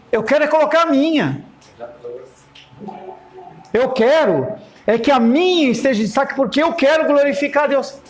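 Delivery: voz mais aguda de alguém exibido; caçoe de quem quer destaque, bem mais agudo; imitando pessoa vaidosa. Retold or imitated. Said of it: "outra pessoa falar assim". (imitação aguda de pessoa orgulhosa)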